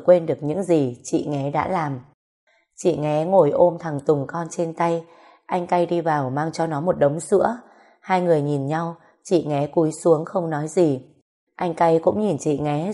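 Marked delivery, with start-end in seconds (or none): none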